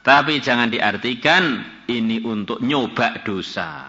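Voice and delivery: usual speaking voice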